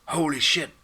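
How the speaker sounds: high, loud startled cry; shocked exclamation. Acted. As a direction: blurt it out in shock. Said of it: "I S R announced".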